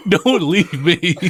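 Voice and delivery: slow, high, drawn out through laughter; laughing plea. Real, not acted. Co-host begging host not to leave, laughing. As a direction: wail it jokingly while cracking up.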